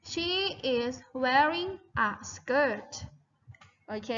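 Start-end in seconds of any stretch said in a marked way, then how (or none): 0.1-2.9 "she is wearing a skirt" drawn out word by word; slow model sentence. Shown as a demonstration. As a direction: say it slowly so they can repeat. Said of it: "one more time". (พูดช้าๆ ทีละคำเพื่อให้นักเรียนพูดตาม)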